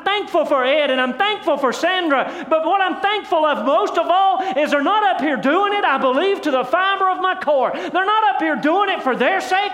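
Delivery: high-pitched, ringing pulpit delivery; impassioned sermon climax, pitched high; full-force preaching. Real, not acted. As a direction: preach it with rising fervor to the whole church.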